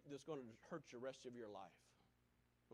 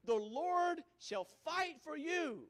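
louder, higher, slow proclamation; proclaiming scripture; 6 semitones higher, 6 dB louder, slower than usual. Acted. Preacher proclaiming Moses' promise, slow and loud. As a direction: declare it with conviction.

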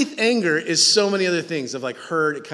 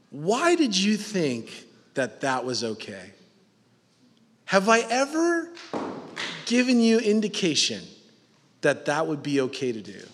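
soft, unhurried fatherly asking; gentle questioning; 2 semitones lower, 6 dB quieter than usual. Acted. A father calmly reasoning with his son, soft.

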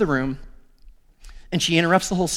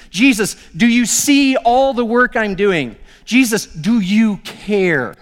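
louder, slow, pressing questions to Jesus; pleading complaint; 3 semitones higher, 3 dB louder, slower than usual. Acted. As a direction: demand an answer, word by word. Martha confronting Jesus, acted.

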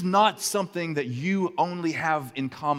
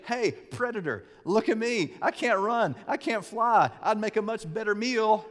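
lightly voiced pleading bird, a bit higher; crying killdeer; 8 semitones higher than usual. Killdeer begging a predator, a touch higher and pleading. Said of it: "she starts to cry as if it's broken".

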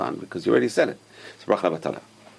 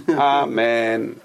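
balanced long amen. Shown as a demonstration. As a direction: lengthen amen without breaking the word. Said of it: "That's how a person should say amen".

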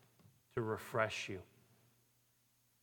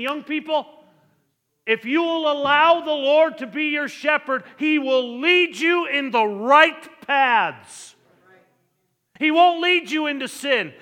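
thundering pulpit crescendo ending in a shout; full-force preaching; 10 semitones higher, 12 dB louder than usual. Acted. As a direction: hammer home each phrase to young people. Climax of the sermon.

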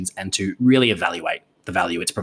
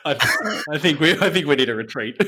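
laughing; 5 semitones higher than usual. Real, not acted. Cracking up at joke.

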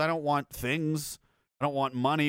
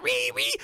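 high, strained mock screech of rage; mock ree screech. Mocking angry online crowds with a high screech. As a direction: screech like an outraged internet troll.

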